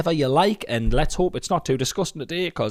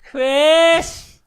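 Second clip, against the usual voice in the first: high, loud imitated call; 7 dB louder than usual. Husband imitating family calling him down the corridor, calling out. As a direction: yell it after someone leaving the room.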